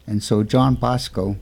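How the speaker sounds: plain reading voice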